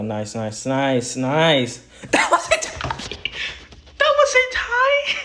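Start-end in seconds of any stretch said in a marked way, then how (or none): 2.1-5.2 high laughing shout with a voice crack. Real, not acted. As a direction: yell it so the voice cracks upward. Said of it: "Holy voice crack".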